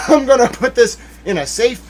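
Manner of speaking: high, loud run of escalating boasts; imitated gleeful scheming. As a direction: act out her plan, piling it on excitedly. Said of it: "She was like".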